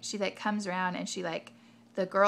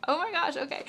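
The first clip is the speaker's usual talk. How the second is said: mock alarm; 8 semitones higher, 3 dB louder than usual. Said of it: "we're like".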